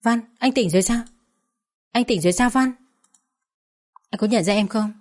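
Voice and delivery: flustered excitement. A narrator's reading. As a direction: rush the words out, overwhelmed. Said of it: "cuống lên và nói".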